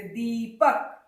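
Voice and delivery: दीपक with दी drawn out; slow, stretched दी, then पक; long ee. Shown as a demonstration. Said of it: "लंबी की ओर जा रही है".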